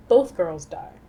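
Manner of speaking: normal talking voice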